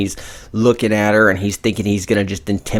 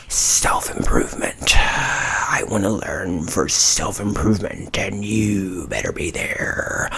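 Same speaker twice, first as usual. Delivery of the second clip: slow, drawn-out stage whisper; hushed, slow imitation of a menacing reply; acted whisper; 5 dB quieter, slower than usual. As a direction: whisper it slowly, like a veiled order.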